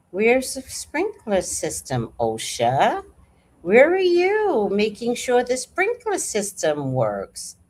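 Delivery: slow pointed questioning; pointed questions. Real, not acted. Challenging osha about sprinklers, slow and pointed. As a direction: press each question slowly.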